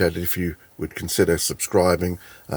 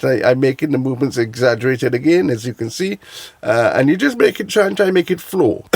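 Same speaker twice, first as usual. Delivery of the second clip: high, loud comedic accent; Raz accent held; 5 semitones higher, 5 dB louder than usual. Shown as a demonstration. Creator keeps the Raz accent while explaining, loud. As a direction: keep the Raz accent going, bouncy. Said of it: "it's an accent I practice".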